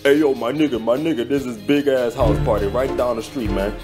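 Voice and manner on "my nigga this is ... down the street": ordinary delivery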